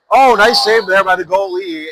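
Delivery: real excitement at a great stop; excited call. Real, not acted. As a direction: jump up for the big save. Sudden save during play-by-play.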